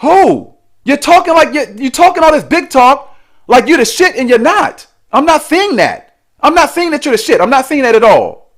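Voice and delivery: high-pitched, scornful disbelief; indignant put-down. Real, not acted. Scornful rebuttal to the woman's boasting.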